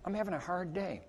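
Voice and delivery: subdued sad reply; quiet downcast reply. Retold or imitated. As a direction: mumble it like someone having a rough day. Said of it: "I'd walk up there".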